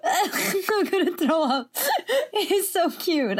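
slow words squeezed out through laughter; wheezing with laughter; laughing. Real, not acted. Laughing at a silly drawing.

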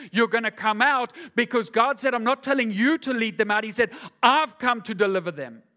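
loud, rising preacher's declaration; full-force preaching. Real, not acted. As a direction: drive it home to the congregation.

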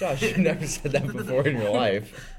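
loud teasing disbelief. Real, not acted.

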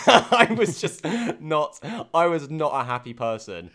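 high, loud words through laughter; real laughing. Real, not acted. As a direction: laugh while admitting how cross you were. Laughs while admitting her anger.

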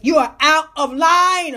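stern slow scolding; emphatic repeat. Acted. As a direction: lay it down word by word. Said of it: "repeated catchphrase, slowed".

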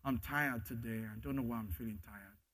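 soft, low, intimate prayer to Daddy; heartfelt prayer. Shown as a demonstration. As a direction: confide in a father quietly. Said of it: "Just say it from your heart".

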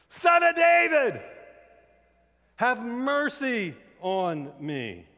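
full-force high scream, very drawn out. Retold or imitated. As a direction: bellow the plea at the top of your voice, slowly. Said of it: "He just yelled louder".

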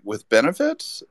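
can't believe his luck; incredulous question. Retold or imitated. To the editors about the staff job, incredulous.

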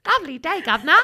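loud mock Cockney greeting; hammed-up working-class British accent; poor British accent. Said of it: "poor British people accents".

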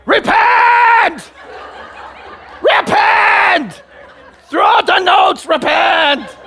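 huge high-pitched drawn-out bellow; mock preacher's shout. Preacher playing up the thunder to his congregation, bellowing. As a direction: holler it like a hammed-up street evangelist.

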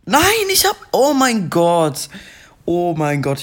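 real frustrated scream. Real, not acted. Gamer dying on stream lets out a real frustrated scream. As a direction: scream as the run is lost.

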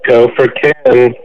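muffled, like talking underwater; bobbing in water. Real, not acted. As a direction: voice through a bad headset. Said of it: "it sounds like you're underwater".

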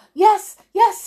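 high, loud, panicky agreement; panicked cry. A narrator's reading. Mister Mean remembering the wizard's words, panicking. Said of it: "he cried in a panic".

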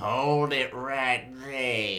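slow, far-off cowboy drawl; cowboy bandit voice. Pretend. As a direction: play a menacing outlaw. Bandit ambush, gruff standoff.

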